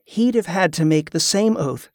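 plain reading voice

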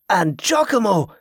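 exultant. A narrator's reading. Pirate greets a second lost friend, exultant. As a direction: hail an old friend with delight. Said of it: "sounding genuinely exultant".

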